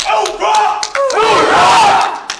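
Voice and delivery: huge high battle cry; shouting. Real, not acted. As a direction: roar it out with the whole unit. Group shouts after ceremony.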